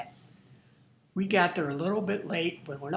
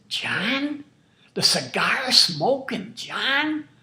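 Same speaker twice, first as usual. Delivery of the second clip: louder, higher, slow astonished question; surprised recognition; 5 semitones higher, 6 dB louder, much slower than usual. Retold or imitated. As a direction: act the stranger's double take. Stranger recognises the name.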